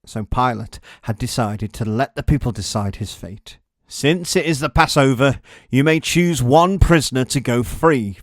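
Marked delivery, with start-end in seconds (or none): 3.9-8.2 louder, proclaiming governor; governor's announcement. A narrator's reading. Pilate addressing the crowd, loud proclamation. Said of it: "Pilate announced".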